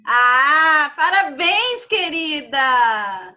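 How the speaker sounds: loud, drawn-out congratulations; excited greeting. Real, not acted. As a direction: cheer it out slowly. Greeting birthday girl loudly.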